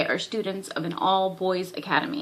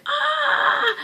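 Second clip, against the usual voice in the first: high, drawn-out mock cry of embarrassment; embarrassed squeal. Retelling the boy beside the girl by the river, mock-embarrassed squeal. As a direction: imitate a flustered anime boy's high yelp. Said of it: "so embarrassed".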